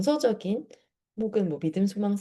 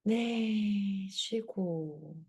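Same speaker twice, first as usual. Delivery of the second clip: soft, slow breathing guide; guided breathing cues; 3 semitones higher, 3 dB quieter, much slower than usual. Shown as a demonstration. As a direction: cue each breath gently and slowly. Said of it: "사 초 한번 해볼게요".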